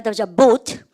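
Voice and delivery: bought said as "보트", no gh sound; model pronunciation. Shown as a demonstration. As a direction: bought with a clear t ending. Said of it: "발음해볼까요?". (bought를 "보트"로 또박또박 발음)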